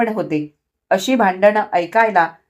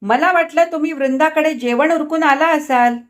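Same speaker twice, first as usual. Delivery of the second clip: sarcastic; 6 semitones higher, 3 dB louder than usual. A narrator's reading. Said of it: "तिने उपहासाने म्हटले".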